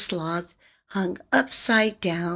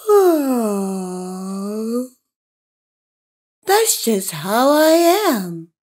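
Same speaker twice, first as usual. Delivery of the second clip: sleepy yawning sloth; 4 semitones higher, 5 dB louder, much slower than usual. Acted. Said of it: "the sloth yawned and said".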